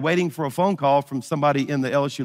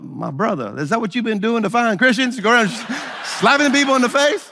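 incredulous; 6 semitones higher than usual. Retold or imitated. Incredulous at slapping Christians.

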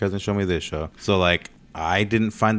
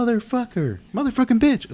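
put-on much higher, rising squeaky toddler voice; kid voice; 4 dB louder than usual. Father voicing his young son cussing, much higher. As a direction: squeak it like a gleeful little boy.

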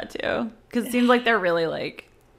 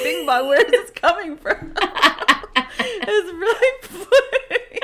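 high, laughing; 10 semitones higher than usual. Real, not acted. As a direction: giggle through the words. Laughing fit.

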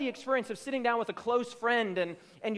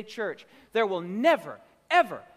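ordinary talk

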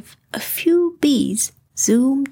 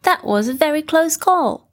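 relieved Rabbit; 6 semitones higher than usual. Acted. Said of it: "said Rabbit".

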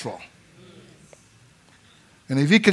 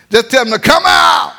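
higher, loud exhortation; preacher urges church to command demons; preaching shout; 6 semitones higher, 4 dB louder than usual. Acted. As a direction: drive the point home.

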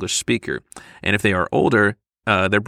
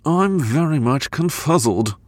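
slow, affected prim British voice; Gatsby; 4 semitones higher, slower than usual. Acted. Bored traveller showing off an impression to his companion, affected Gatsby voice. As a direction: draw it out slowly, prim and proper. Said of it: "Was that your Gatsby impression?".

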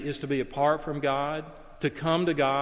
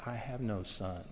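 cold father; 5 semitones lower, 12 dB quieter than usual. Acted. Father rejecting son coldly.

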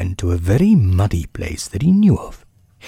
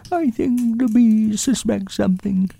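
very high, small voice for a pondering bear; high-pitched bear explaining earnestly; Winnie the Pooh. A narrator's reading. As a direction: speak as a thoughtful little toy bear, high and thin.

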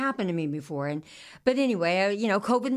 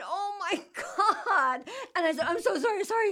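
high embarrassed apology; 10 semitones higher than usual. Retold or imitated. Apologizing to stranger.

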